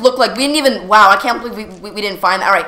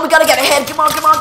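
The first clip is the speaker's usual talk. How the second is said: ordinary talk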